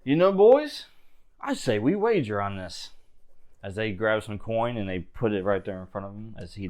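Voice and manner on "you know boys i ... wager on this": Smith's sly wager pitch. Acted.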